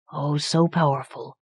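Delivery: quiet, hushed awe; softly. A narrator's reading. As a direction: let it fall to a hush. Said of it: "Per said softly".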